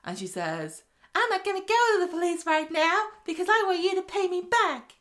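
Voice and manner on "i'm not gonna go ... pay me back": lightly put-on foreign accent, much higher; a hint of Gabrielle's accent, pitched up. Podcaster imitating Gabrielle threatening her husband, put-on accent. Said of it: "Ac-, accents again".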